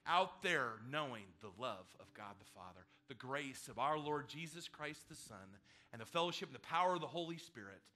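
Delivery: solemn blessing, rising then slowing; benediction. Real, not acted. Pastor blessing the departing congregation, solemn. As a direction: bless them as they leave.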